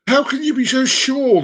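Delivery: high-pitched indignant objection; protesting. Acted. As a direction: challenge it as an upset pet owner. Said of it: "the woman protests".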